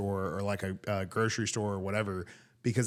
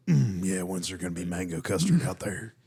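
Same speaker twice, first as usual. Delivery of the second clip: lower-pitched hungry buffet regular; buffet customer voice; 3 semitones lower than usual. Acted. Comic as a diner asking after mango custard, buffet voice. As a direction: put on an impatient big eater's voice. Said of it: "do your best buffet voice".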